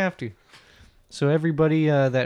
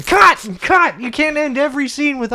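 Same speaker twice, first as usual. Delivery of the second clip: much higher, louder shouted cut; director yelling cut; 10 semitones higher, 5 dB louder than usual. Pretend. Pretend director stopping the scene, loud and high. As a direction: bark it like a fed-up director.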